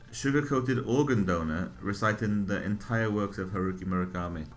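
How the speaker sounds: usual speaking voice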